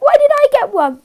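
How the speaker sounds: high, loud mock complaint of a forgotten child; whiny upset kid. Youtuber mimics a forgotten viewer, hurt squeal.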